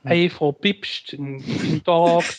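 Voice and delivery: slow, put-on Saxon greeting; mock Saxon. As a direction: greet her drawling like a Saxon. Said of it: "dann macht er halt so Sächsisch".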